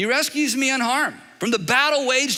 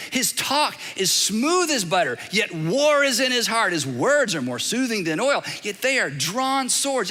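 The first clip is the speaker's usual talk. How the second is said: ordinary talk, nothing marked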